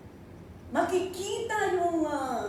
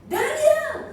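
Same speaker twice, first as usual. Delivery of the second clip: calling out, higher and louder; anguished call; 10 semitones higher, 8 dB louder than usual. Acted. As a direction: cry out his name in anguish. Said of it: "he called Daniel in an anguished voice".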